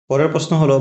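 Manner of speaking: ordinary talk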